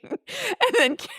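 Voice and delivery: very high, giggling squeak; real laughter squeezing the voice high; talking through laughter. Real, not acted. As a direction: barely get it out for laughing. Cracking up while retelling absurd scene.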